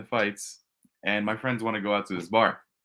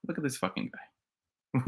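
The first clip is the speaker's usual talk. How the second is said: softer, slow snide aside; low-key dissing; 2 semitones higher, 6 dB quieter, slower than usual. Retold or imitated. Picking a fight at the bar, low-key diss. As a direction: drag it out as a quiet jab. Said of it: "low-key dissing people around me".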